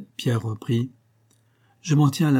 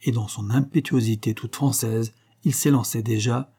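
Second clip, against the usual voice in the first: ordinary delivery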